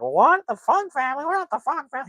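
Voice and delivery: very high, squealed mock outrage; exaggerated high-pitched teasing imitation; mocking shocked reaction. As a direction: mimic their shock in a high cartoon voice. Instructor mimics the family's shocked reaction.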